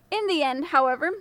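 ordinary delivery, nothing marked

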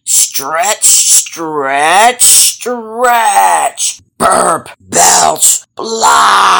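building to a shout. Acted. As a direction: start tight, build to a belching yell. Snake bursting, spits all out.

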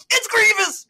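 high, loud alarmed cry; panicked yell. Retold or imitated. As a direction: warn the team in a panic. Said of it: "I just hear Dontre go".

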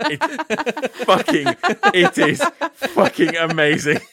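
slow, high, loud through laughter; real helpless laughter on the words; laughing hard. Real, not acted. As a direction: gasp it out while cracking up. Cracking up over favourite sitcom scene.

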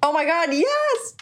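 excited. Real, not acted. Excited about karaoke plan.

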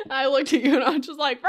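high voice breaking into giggles; laughing to tears. Real, not acted. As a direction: struggle to talk through real laughter. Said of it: "I'm crying".

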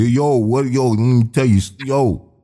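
demonstrating New York slang and accent. Shown as a demonstration. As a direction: put on a New York accent, tossing out each "yo". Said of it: "if you had a New York accent".

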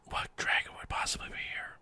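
hushed doubtful question; whispering. Acted. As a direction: whisper it skeptically. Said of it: "why are you whispering".

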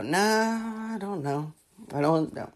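soft, doubtful brush-off; mock reluctance. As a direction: mumble your doubts as an imagined executive. Imagined executive waves idea away.

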